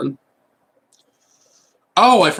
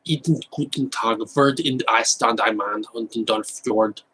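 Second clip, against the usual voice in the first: made-up German, said with conviction; mock German. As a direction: fake German gibberish. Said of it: "I'm just saying gibberish".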